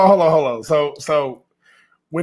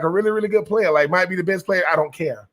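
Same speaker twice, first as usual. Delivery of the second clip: usual speaking voice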